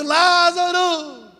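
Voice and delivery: very high, long-drawn call; slow stretched mock call. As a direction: call him from the tomb. Jesus calling Lazarus out.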